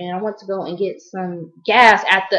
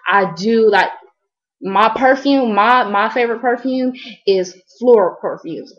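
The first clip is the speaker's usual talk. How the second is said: usual speaking voice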